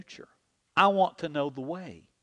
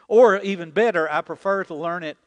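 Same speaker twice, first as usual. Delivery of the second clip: normal talking voice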